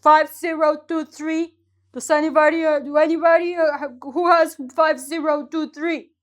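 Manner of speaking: a hint of a raffle host calling the winner; prize announcer. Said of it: "the person in the scenario is like".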